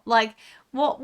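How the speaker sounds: usual speaking voice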